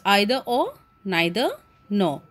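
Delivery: "either or, neither nor" as EYE-ther or, NYE-ther nor; UK pronunciation. Shown as a demonstration. Teacher telling Indian students which to follow, "either" and "neither" with an "eye" vowel. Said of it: "We follow U K generally".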